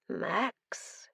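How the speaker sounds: breathy hushed name, a touch of a madman; breathed name. Acted. Madman breathing Max's name at him, hushed. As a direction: barely voice it, almost a breath. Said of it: "he breathed".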